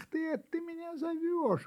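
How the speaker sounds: high surprised cry. Acted. Kitten surprised to learn the puppy was calling. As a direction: gasp it out in surprise. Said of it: "удивился котёнок".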